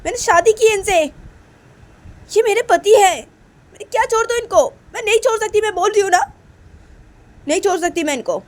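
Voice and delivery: put-on high, loud protesting voice of a young wife; wife refusing to leave her husband, high and indignant; pleading wife. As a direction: cry out to her mother, pitched high in disbelief. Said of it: "तो तभी माँ बोलती कि".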